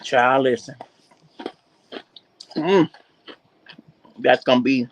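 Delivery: talking while crunching snacks; words round a crunchy bite; crunching chips. Real, not acted. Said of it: "excuse my crunchy. You caught me right when I was crunching".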